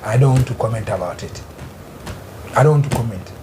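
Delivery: low-pitched, withdrawn refusal to comment; reticent refusal. Real, not acted. Panelist declining to comment to the host, low and reticent. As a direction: drop right down low and decline to engage.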